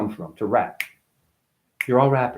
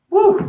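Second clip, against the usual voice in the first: whoop; 7 dB louder than usual. Real, not acted. Getting up, letting out a whoop.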